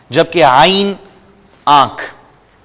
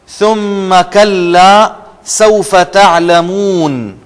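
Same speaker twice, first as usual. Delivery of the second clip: slow, higher melodic recitation; Quranic recitation; 3 semitones higher, 3 dB louder, much slower than usual. Shown as a demonstration. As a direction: recite it slowly like tajweed. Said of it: "سا کے نیچے یہاں پر آپ line لگا لیں".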